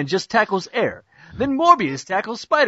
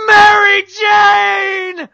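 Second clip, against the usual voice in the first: Mary Jane dragged out in a high, very loud wail; hammed-up anguished cry; 12 semitones higher, 9 dB louder, much slower than usual. Spider-Man finds Mary Jane tied up, hammed-up high wail. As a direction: wail her name like a melodramatic hero.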